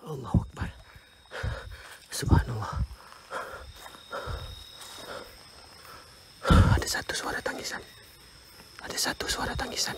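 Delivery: soft, voiceless whisper. Real, not acted. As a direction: hush it so the ghost won't hear. Hearing crying at night. (bisikan perlahan)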